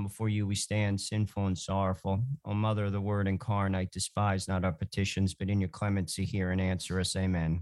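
low, steady recited prayer. Acted. Leads the Memorare prayer.

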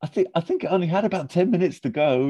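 very high, timid mock voice; high meek Sean. Sean meekly answering Liz. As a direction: answer sheepishly. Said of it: "And he goes".

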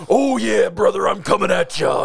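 shouted, growly wrestler promo; Macho Man voice. Pretend. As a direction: hype yourself up like a wrestler. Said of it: "the Macho Man voice".

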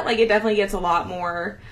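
normal talking voice

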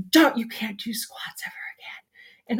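whispering the doctors' warning; a retold doctor's hushed warning; mock doctor whisper. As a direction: hush it like a grim verdict.

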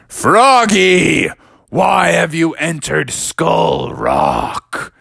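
theatrical spooky interrogator; scary voice. Acted. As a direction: draw it out to intimidate an intruder. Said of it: "your voice is pretty scary".

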